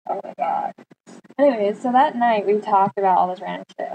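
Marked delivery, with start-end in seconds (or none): 0.1-0.7 soft, low, whispered horror; mortified whisper. Retold or imitated. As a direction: whisper in embarrassment.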